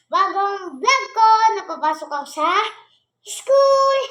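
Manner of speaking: loud, high vlog plug, rising to a shout; calling out. Real, not acted. Vlogger plugging the new school vlog to viewers, calling out. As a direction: belt it out, leaping up high on the final word.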